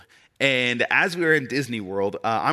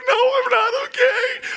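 put-on very high, louder crying child voice; bawling little boy; 4 dB louder than usual. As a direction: wail it like a sobbing five-year-old. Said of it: "And I'm like".

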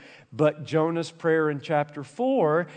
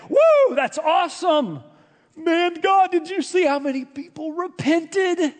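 mock cheering; 11 semitones higher than usual. Jonah imagined celebrating God's mercy.

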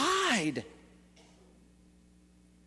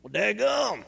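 put-on joking Southern accent; slower than usual. Preacher joking about his slip, Southern accent. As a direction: play up the Southern accent for a laugh. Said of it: "went into a southern accent".

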